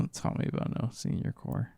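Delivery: breathy whispered grumble; whispering. Real, not acted. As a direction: hush it, half to yourself. Groaning aside about senior core.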